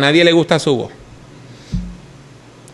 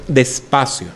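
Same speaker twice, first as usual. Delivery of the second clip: slow "despacio" in a lower voice; slow, deliberate; 7 semitones lower, 3 dB quieter, slower than usual. Shown as a demonstration. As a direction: ease down and space out the word. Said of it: "tiene que hablar más".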